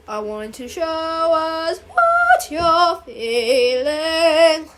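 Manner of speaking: very drawn-out, singsong drama teacher; theatrical drama teacher. Acted. Drama teacher setting the giant warm-up, theatrical singsong. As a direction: sing the words out, stretching every vowel.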